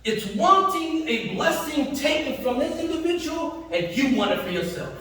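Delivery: normal talking voice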